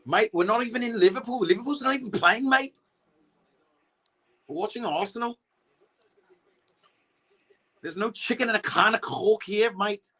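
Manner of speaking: playful Liverpool Scouse impression, jokingly obliging chat; Scouse accent. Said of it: "do a Scouse, do a Scouse accent".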